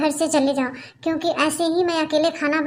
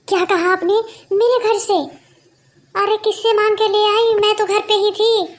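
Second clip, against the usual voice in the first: shocked outburst; 5 semitones higher than usual. Acted. Neighbour shocked her plates were taken.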